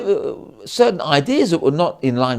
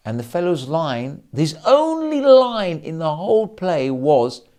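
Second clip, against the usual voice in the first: normal talking voice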